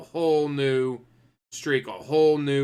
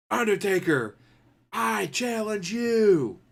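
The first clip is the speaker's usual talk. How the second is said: high, drawn-out mock challenge, very slow; dramatic callout; 7 semitones higher, much slower than usual. Young wrestler calling out the Undertaker, hammed-up and slow. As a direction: stretch the challenge out like a wrestling promo.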